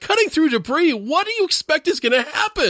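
very high-pitched, exasperated disbelief; incredulous outburst. Acted. Podcaster reacting to the death ray excuse, incredulous. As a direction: throw the excuse back in disbelief. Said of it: "I'm like".

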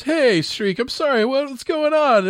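high, chummy mock cop voice; Ridzik impression. Ridzik voiced in retold scene.

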